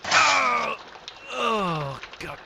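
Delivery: mock hurt after a blow; pained groan. Detective just punched by the maid, groaning in pain. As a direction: wince through being struck. The warden hit me.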